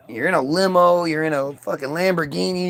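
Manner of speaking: ordinary talk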